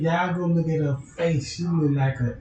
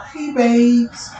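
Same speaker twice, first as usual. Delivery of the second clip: louder higher hey babes; flirty call; 6 semitones higher, 7 dB louder than usual. Retold or imitated. In the club greeting his two dates, flirty and loud. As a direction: show off to the ladies.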